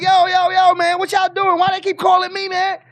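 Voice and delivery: high, loud, exasperated rant; mock mayor complaining loudly about the calls; upset mayor. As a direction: vent like she's fed up with the calls. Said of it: "she would get upset with that".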